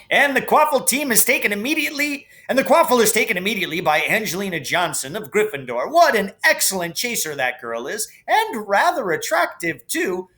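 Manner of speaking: fast, high, excited play-by-play; match commentary. Acted. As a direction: rattle off the action like a schoolboy commentator. Lee Jordan commentating the match.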